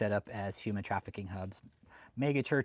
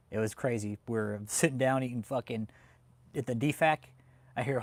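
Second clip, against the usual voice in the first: ordinary delivery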